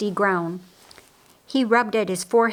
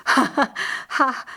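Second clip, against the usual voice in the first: higher, halting acted laugh; lopsided laugh; 5 semitones higher than usual. A narrator's reading. Laz laughs after teasing Martha, impaired laugh. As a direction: laugh weakly through a half-working mouth. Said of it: "Lazarus laughed with a lopsided grin".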